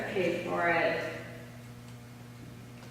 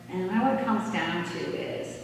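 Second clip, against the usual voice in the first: ordinary talk, nothing marked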